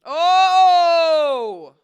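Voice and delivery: loud startled gasp; shocked. Real, not acted. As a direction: caught off guard, crying out. Shocked by rude caller question.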